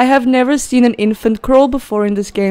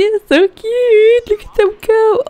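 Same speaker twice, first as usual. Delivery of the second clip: cooing; 11 semitones higher than usual. Real, not acted. Player watching the babies crawl, high coo. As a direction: coo at them like they are tiny.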